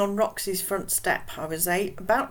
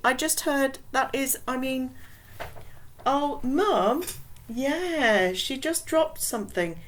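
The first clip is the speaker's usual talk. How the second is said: put-on flustered girl; 7 semitones higher than usual. Roxy covering for her mum.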